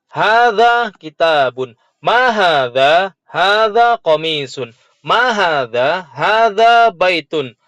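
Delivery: slow, deliberate model reading for a class; slow recitation drill. Shown as a demonstration. Teacher modelling Arabic sentences for students, slow and clear. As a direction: recite each question and answer slowly for students to repeat.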